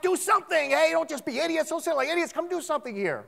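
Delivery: high, loud urgent demand; crying out. Acted. Loved one's relative to idle doctors at a flatline, crying out. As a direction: cry out at people who just stand there. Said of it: "You'd cry out".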